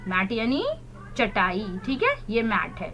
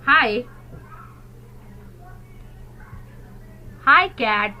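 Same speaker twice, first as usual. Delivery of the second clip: put-on teacher reading aloud to children; slow model reading; 2 semitones higher, much slower than usual. Shown as a demonstration. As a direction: say it clearly so the class repeats. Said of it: "Repeat after me".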